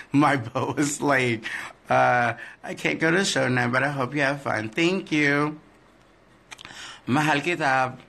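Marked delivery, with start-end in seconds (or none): none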